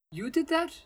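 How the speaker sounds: high, stunned question; dumbfounded. A narrator's reading. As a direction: ask it in disbelief.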